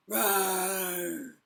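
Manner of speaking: roar. Acted. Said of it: "the boy roared at Theodore".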